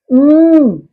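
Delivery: "उम" as a long hummed mmm; closed-lip M sound. Shown as a demonstration. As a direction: hum "उम" with both lips shut. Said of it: "बंद करके आवाज निकालेगा". ("उम" होंठ बंद करके लंबा म्म्म)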